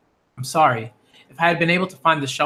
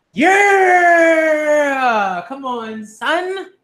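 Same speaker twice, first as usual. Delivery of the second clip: hype shout; 10 semitones higher, 5 dB louder, much slower than usual. Real, not acted. Cheering for Ray.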